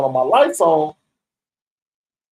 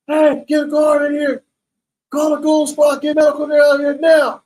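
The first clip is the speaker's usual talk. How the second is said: imitating guard yelling; 10 semitones higher than usual. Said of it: "While he get to yelling".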